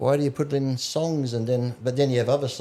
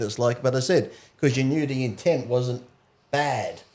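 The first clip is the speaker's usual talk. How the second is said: ordinary talk, nothing marked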